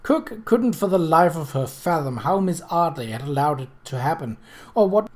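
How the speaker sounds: ordinary delivery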